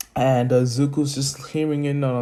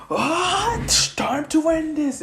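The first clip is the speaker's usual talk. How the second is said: much higher heroic shout; finishing battle cry; slower than usual. Acted. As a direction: yell as you go for the final blow. Izuku's finishing move.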